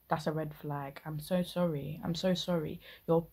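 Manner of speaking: ordinary talk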